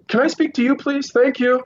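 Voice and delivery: high-pitched, retold polite request; calling to a stranger. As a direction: re-enact calling across a crowd for help.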